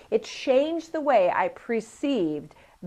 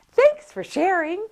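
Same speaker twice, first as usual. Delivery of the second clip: "Thanks for sharing" high, loud and rising; high bright practice take; 10 semitones higher, 7 dB louder than usual. Shown as a demonstration. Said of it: "I stood in front of the mirror".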